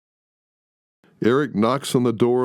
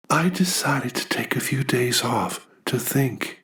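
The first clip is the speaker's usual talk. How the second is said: whisper; 5 semitones higher than usual. Acted. Catherine withdrawn, avoiding eye contact.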